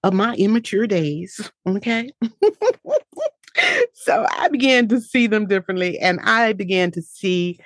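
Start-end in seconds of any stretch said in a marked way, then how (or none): 2.2-3.3 high, light chuckle; self-amused laugh. Real, not acted. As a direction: laugh it off after a confession. Laughs after admitting immature days.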